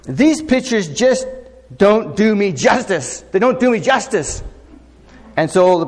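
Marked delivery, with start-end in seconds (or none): 0.0-4.4 high, slow, fussy complaint; mock-upset lady, much higher and slow. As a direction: play the miffed customer, pitched up. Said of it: "she was quite upset".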